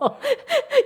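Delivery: high-pitched giggle; high laugh. Real, not acted. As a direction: laugh at the irony. Laughs about Jeju ending.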